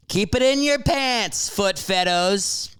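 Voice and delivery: high, drawn-out mocking tease; teasing. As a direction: taunt the listeners playfully. Teasing foot fetish listeners.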